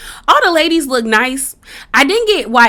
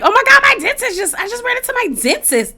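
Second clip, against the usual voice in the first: much higher, fast, breathless; giddy Erica impression; 10 semitones higher, faster than usual. Erica gushing about running into her dentist, high and fast. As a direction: gush like a giddy girl spotting a crush. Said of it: "Erica's like".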